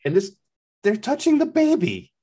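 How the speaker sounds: high, loud and incredulous; exasperated outcry. Real, not acted. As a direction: cry it out in high-pitched disbelief. Outraged recap of contaminated characters' actions.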